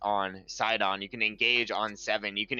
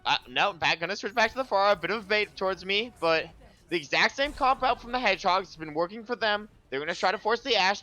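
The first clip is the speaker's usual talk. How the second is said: higher, energetic live commentary; play-by-play commentary; 9 semitones higher than usual. Acted. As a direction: call the action as it happens.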